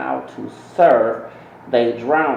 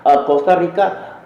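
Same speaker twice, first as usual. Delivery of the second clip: Costa Rica with a Spanish accent, rolled and rising; 5 semitones higher, 4 dB louder than usual. Shown as a demonstration. Vlogger showing off his Spanish accent on the country's name. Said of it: "yeah, I'm using my accent".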